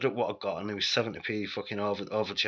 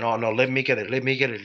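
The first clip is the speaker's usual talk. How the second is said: loud, badly faked Irish accent; insistent mock Irish; attempted Irish accent; 3 semitones higher, 8 dB louder than usual. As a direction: have a rough go at an Irish accent, louder. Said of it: "Can't do an Irish accent".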